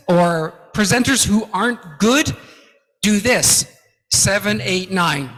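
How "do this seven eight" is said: ordinary delivery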